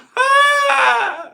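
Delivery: screaming laugh; high wheezing cackle. Real, not acted. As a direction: burst out in a shrieking laugh. Laughs at chat joke.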